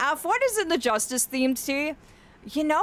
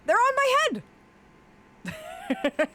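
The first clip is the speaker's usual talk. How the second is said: surprised squeal; 13 semitones higher, slower than usual. Real, not acted. Surprise at the question.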